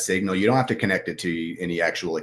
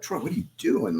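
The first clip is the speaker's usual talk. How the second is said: mock self-scolding; 7 semitones higher, 4 dB quieter than usual. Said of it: "you had to take a step back".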